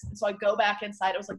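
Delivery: usual speaking voice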